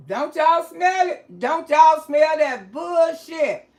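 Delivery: loud and slow, asking the audience to smell the lie; indignant call-out. Real, not acted. Cohost calling out the story.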